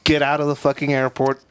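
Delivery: emphatic warning. Real, not acted. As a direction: warn everyone off Mexico City, raising the voice. Warning about missed flights.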